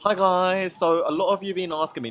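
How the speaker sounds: a hammed-up British influencer saying hi to her followers; British influencer impression. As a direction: play a bubbly British beauty influencer opening her video. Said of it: "Shall I do one?".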